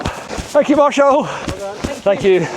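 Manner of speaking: calling out thanks. Real, not acted. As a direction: shout your thanks over to him. Calling thanks to marshal running past.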